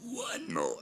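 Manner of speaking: words burped out low; burping the words. Real, not acted. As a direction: burp the words out.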